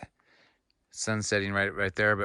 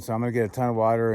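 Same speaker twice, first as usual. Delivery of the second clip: ordinary talk, nothing marked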